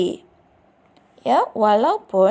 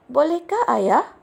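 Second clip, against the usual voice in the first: bolehkah pitched high and louder; eager asking; 6 semitones higher, 5 dB louder than usual. Acted. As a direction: plead eagerly with father. Child begging father to join. (bertanya dengan penuh harapan)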